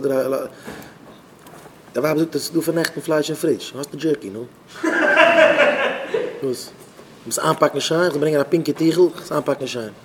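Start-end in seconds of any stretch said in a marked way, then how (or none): 4.7-6.4 high, loud burst of laughing; high-pitched cackling laugh; laughter. Real, not acted. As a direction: laugh out loud in a quick run. Burst of laughter after the joke.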